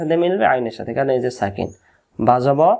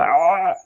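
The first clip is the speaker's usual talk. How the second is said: fishbone-in-throat noise; 3 semitones higher than usual. Shown as a demonstration. Teacher likens Ain to a fishbone caught, straining throat noise. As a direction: clear a stuck fishbone with a constricted "aaa". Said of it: "মাছের কাঁটা লাগে না অনেক সময় আমাদের গলা".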